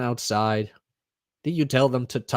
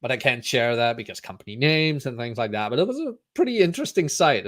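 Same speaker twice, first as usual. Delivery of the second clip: normal talking voice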